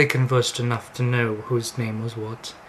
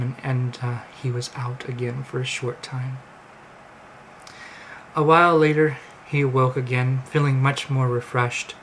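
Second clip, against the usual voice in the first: usual speaking voice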